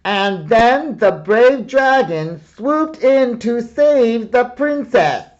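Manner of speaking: loud, high storyteller boom. Acted. Boy reads aloud to the hidden dragon, booming. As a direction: read it big to lure the dragon. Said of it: "read aloud in a booming voice".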